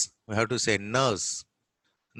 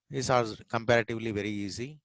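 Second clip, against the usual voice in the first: ordinary talk, nothing marked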